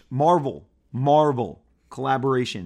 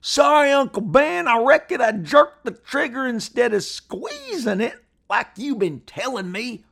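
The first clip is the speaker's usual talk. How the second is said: put-on much higher, loud, theatrical western boy voice; old TV western actor; 11 semitones higher, 8 dB louder than usual. Shown as a demonstration. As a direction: overplay it like a Bonanza episode. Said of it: "wonder if it was said like this".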